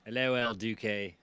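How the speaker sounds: normal talking voice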